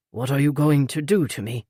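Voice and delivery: lightly voiced older British man; a gentle hint of the polite Peter; older British gentleman, lightly voiced; Peter's voice. A narrator's reading. Peter's voice in read story.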